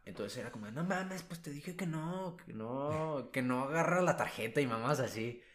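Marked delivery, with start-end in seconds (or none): 0.7-4.6 mock-angry couple bickering, higher pitched; angry caller. Call-center worker retelling an angry Yucatán couple, mock anger. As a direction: replay a quarreling caller, half-hearted anger. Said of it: "estaban enojados por un tema".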